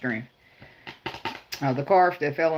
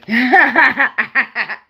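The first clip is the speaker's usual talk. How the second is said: loud laugh; 7 semitones higher, 9 dB louder than usual. Real, not acted. Laughing at her own joke about forgiveness. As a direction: burst of real laughter.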